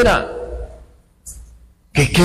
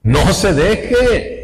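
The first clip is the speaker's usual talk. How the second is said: pleading; 5 semitones higher, much slower than usual. Real, not acted.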